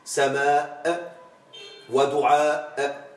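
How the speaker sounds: سماء and دعاء said slowly, each final aa held before the hamza; slow madd in سماء ودعاء; long madd examples. Shown as a demonstration. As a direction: draw out the endings of سماء and دعاء. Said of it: "كما تقول".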